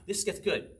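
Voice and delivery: ordinary talk, nothing marked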